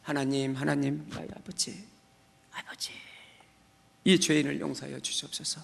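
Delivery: soft, whispered plea; tearful whispered prayer. Retold or imitated. Sinner whispering a tearful prayer for forgiveness. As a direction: whisper the confession through tears. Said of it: "눈물이 나서".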